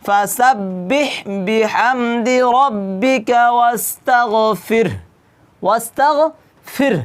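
slow chanted verse with the ending sounded; Quran recitation. Shown as a demonstration. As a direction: recite slowly and voice the final sound. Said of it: "হাটা একটু কি করতে হবে? উচ্চারণ করতে হবে". (ধীর তিলাওয়াত, শেষ অক্ষর উচ্চারণ)

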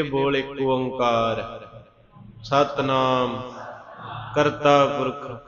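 slow, drawn-out chant of the Japji, word by word; slow recitation. Shown as a demonstration. Preacher teaching the congregation the Japji, slow sung chant. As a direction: intone each phrase slowly for the learner to repeat.

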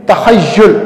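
"तखय्युल" ending in -yul, not -yal; correct pronunciation. Shown as a demonstration. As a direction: say "तखय्युल" with a short u in the last syllable, "ta-khay-YUL". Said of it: "इसे तखय्युल नहीं पढ़ना".

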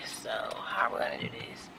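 soft whisper with a laugh. Real, not acted. To viewers while parking, whispering with a laugh. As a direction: murmur it under her breath, unsure. Hushed aside in the driveway.

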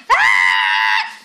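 long, loud, low-pitched yell. Acted. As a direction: let out a sustained "ah" at full volume. Acted cry during the play.

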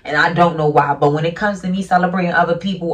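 normal talking voice

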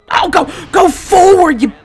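loud, high shout at the game; high-pitched urgent cry; urgent yelling. Real, not acted. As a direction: yell the player onward. Urging on player in game.

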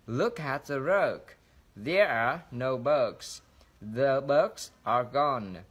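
slow reading with long held vowels in "bug", "rug", "bugs"; drawn-out short U. Shown as a demonstration. Teacher models lengthened vowels for students, "bug" and "rug" drawn out slowly. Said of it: "Chúng ta sẽ phải kéo dài ra".

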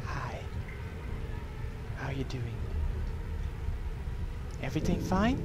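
hushed mock greeting; quiet taunting. Real, not acted. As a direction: whisper a cheeky taunt. Taunting enemy while hidden.

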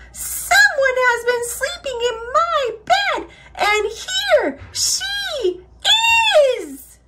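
very high, squeaky outcry; Baby Bear. A narrator's reading. Baby Bear discovering the sleeper, squeaking in alarm. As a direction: squeak it, stretching out the last words.

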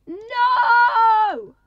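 very high, very loud cry of despair; anguished shout. Real, not acted. Player mourning his parrot, anguished shout. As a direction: scream it out in despair.

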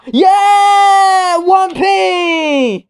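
very high, very loud victory yell; triumphant shout. Real, not acted. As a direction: scream it like a jackpot win. Finally removes the one pence.

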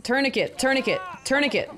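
high, repeated urgent exclamations; urgent call. Real, not acted. Viewer urges film characters to treat a wound, urgent call. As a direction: call out the needed fix urgently.

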